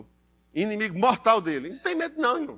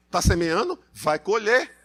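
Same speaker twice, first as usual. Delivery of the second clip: ordinary delivery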